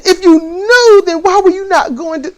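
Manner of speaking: exasperated question. Retold or imitated. Exasperated parent.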